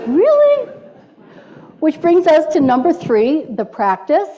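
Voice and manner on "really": much higher, louder "Really?" of wonder; over-the-top delighted "Really?"; high amazed really. Shown as a demonstration. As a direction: squeal it in amazement. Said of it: "We're not alone in this".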